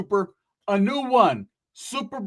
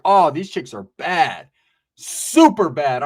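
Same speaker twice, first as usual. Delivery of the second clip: slow, louder drawn-out admiration; admiring; 2 semitones lower, 5 dB louder, much slower than usual. Retold or imitated. Seeing the girls walk in, slow admiring drawl. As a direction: savor each word.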